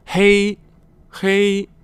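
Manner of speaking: 黑 held high and level; first tone. Shown as a demonstration. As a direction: say 黑 on a loud, high, even first tone. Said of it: "first tone".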